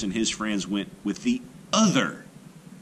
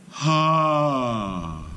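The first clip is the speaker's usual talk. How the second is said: triumphant ha; 6 semitones higher, 4 dB louder than usual. A narrator's reading. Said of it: "he said".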